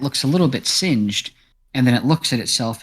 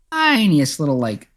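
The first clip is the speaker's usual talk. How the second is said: ordinary talk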